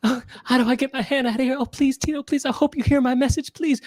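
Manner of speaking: high, pleading mock voice; teasing impression, hammed-up begging; Laura. Mimicking friend begging Tito for help, mock pleading. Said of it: "This is you, Laura".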